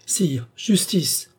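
high, drawn-out plea; slow, imploring cry from an old lady. A narrator's reading. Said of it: "s'écria la vieille dame".

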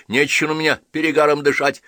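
high, loud snapping; angry rebuke. A narrator's reading. Wife pushing away her husband's boozy breath, high and loud. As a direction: shove him off with words.

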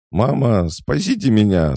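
very high, loud panicked squeal; shrieking for help. A narrator's reading. Trapped girl cries to mama for help, shrieking. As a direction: squeal for rescue, holding it high. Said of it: "заверещала стеклоглазка".

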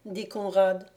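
plain reading voice

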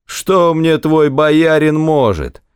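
slow, high, sustained jeer; narrator's drunk official scoffing loftily; drunken blustering. A narrator's reading. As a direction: drag it out high with tipsy contempt. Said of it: "зашумел опять пьяный дьяк".

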